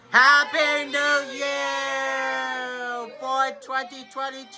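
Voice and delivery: long drawn-out high shout; new year cheer. Real, not acted. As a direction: shout it out at midnight. Midnight cheer.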